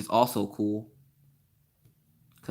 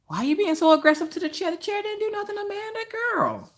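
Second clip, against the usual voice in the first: extremely high, squeaky put-on voice; comic falsetto reproach; mock scolding. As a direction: squeal a playful telling-off, stretching the last words. Mock scolding for abusing a chair.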